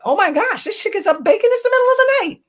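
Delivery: high squeaky mock shock at daughter baking; mock astonishment. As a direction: act out waking to find her baking.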